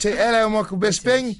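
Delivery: slow, hammed-up British greeting; mock British accent. Host teasing a British co-host, mock British accent.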